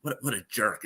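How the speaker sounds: an unvoiced whisper of annoyance under the breath; whispered aside. Muttering "what a jerk" in a crowded elevator, whispered. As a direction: hiss it so the man behind can't hear. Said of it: "I'm like".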